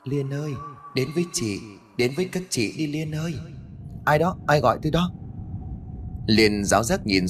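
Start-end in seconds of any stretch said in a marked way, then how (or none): 0.0-3.3 soft, high eerie call; ghostly calling. Acted. Ghostly voice calls Liên at graveyard. 4.0-5.1 high, quick nervous question; startled. Acted. Startled by a voice in graveyard.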